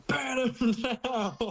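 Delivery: high, stretched-out command; hammed-up frantic demand; mock urgent order. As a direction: drag it out high in fake desperation. Acting out Thomas's urgent order.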